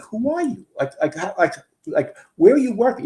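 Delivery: high-pitched mock-baffled questioning; incredulous challenge. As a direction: retell demanding who someone is, pitch jumping up. Challenging stranger amid chaos.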